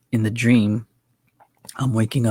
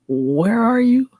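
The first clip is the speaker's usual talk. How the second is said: high, slow, drawn-out question; puzzled calling out; 12 semitones higher, 4 dB louder, slower than usual. Retold or imitated. Dreamer calling to an unseen voice, puzzled and drawn out. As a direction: call out searchingly, stretching the words up high.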